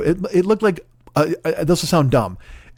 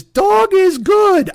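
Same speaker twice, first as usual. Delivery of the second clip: mock childish voice; 13 semitones higher, 5 dB louder, much slower than usual. Mocking crayon-written dog paperwork.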